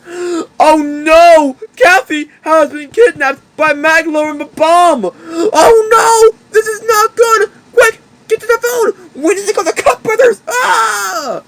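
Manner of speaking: high, fast panicked crying; squeaky creature in a panic; frantic hamster. Acted. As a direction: rush the words in alarm. Wacky panics, rushes to phone.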